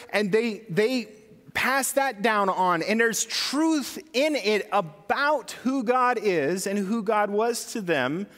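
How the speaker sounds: normal talking voice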